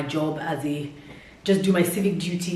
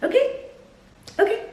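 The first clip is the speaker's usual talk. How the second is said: squeaky high okay. Real, not acted. Emphatic check with listener.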